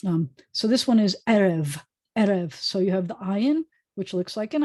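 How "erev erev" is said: "erev" with the accent on the first eh; stress on first syllable. Shown as a demonstration. Teacher modelling a Hebrew word for learners, first-syllable stress. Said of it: "accent's going to be on the first eh".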